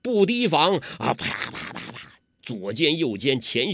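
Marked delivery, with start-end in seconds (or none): 1.1-2.2 voiced palm smacks, breathy and quick; slapping strikes. Narrator imitating palm blows landing on Guo Jing, quick slaps. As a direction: mimic the rapid slaps landing.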